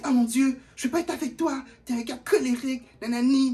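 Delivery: put-on high dramatic girlfriend voice; mocking the girl. Storyteller imitating the girl calling him angry.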